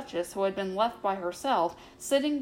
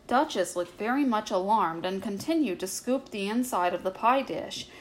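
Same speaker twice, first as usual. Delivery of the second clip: plain reading voice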